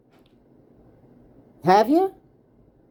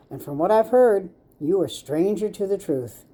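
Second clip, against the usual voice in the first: ordinary talk, nothing marked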